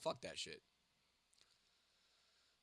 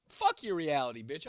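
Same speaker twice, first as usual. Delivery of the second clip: defiant; 3 semitones higher, 9 dB louder than usual. Real, not acted. Defiant rant at chat.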